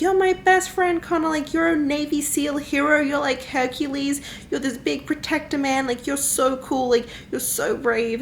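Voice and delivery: a hint of an adoring little girl; lightly voiced little girl. Little girl praising her hero best friend, mock adoring. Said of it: "Like, she's like".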